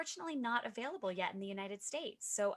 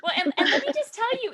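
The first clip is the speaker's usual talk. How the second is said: laughing; 14 semitones higher, 15 dB louder than usual. Real, not acted. Laughing after story.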